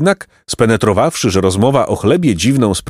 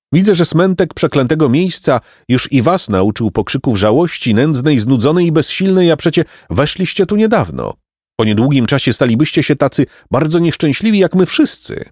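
higher, lightly voiced old man; lamenting old man; 7 semitones higher than usual. A narrator's reading. Golden old man pitying the newcomers, mildly lamenting. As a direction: lament on a raised pitch.

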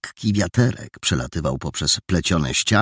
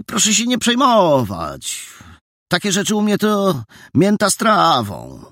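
put-on boyish bravado; 13 semitones higher, 4 dB louder than usual. Maniuś brushes it off.

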